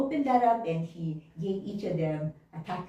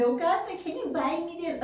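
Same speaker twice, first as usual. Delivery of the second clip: mock childish plea, pitched up; pleading child; 10 semitones higher than usual. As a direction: imitate a child asking for a treat. Acting child begging at store.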